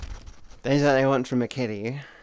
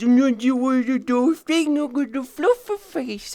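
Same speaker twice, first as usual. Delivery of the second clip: put-on much higher, cooing baby talk; baby talk to a husky; 12 semitones higher, 4 dB louder than usual. Dog lover cooing at a husky doing a thing, high baby talk. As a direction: coo at the fluffy dog like it's a baby. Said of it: "my brain just goes".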